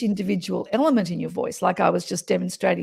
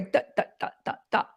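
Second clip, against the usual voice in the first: staccato syllables; 5 dB quieter, much faster than usual. Shown as a demonstration. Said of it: "you speak like this".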